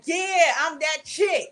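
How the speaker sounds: slow, loud, drawn-out swagger; confident boss chick. Retold or imitated. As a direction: strut it like you own the room. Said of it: "make you feel like".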